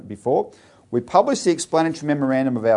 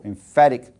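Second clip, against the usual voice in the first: "emphatic" punched louder, rising; stressed emphatic; 5 dB louder than usual. Real, not acted. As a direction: lean hard on "emphatic". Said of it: "not casual".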